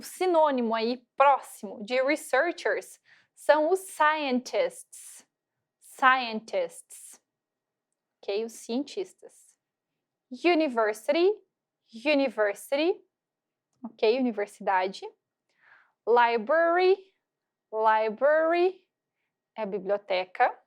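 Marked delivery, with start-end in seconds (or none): 3.8-7.2 very slow "scientists"; careful drill; model pronunciation. Shown as a demonstration. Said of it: "a forma que eu coloquei pra vocês conseguirem pronunciar". 17.7-18.8 slow "library"; careful teacher model; model pronunciation. Shown as a demonstration. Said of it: "Ó a pronúncia".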